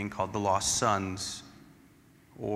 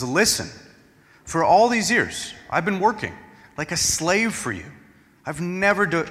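raised, higher, louder bitter protest; angry complaint; 8 semitones higher, 5 dB louder than usual. Acted. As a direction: let the resentment burst out at the father. Elder son's angry complaint to father.

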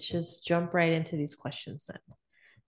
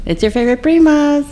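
loud intro call; 10 semitones higher, 15 dB louder than usual. Acted. Podcast intro, called out loud.

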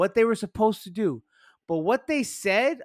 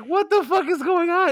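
shocked; 9 semitones higher, 3 dB louder than usual. Retold or imitated. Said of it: "I was like".

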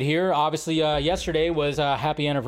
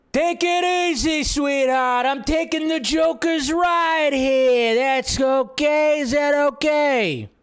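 Penguin gangster voice; 11 semitones higher, 5 dB louder, slower than usual. Retold or imitated. Acting out Penguin quote.

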